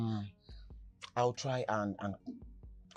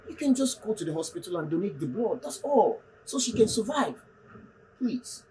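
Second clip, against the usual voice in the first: urgent pleading; 7 semitones higher than usual. Acted. Urgent plea to Desmond.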